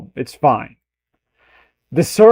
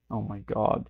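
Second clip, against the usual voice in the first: ordinary talk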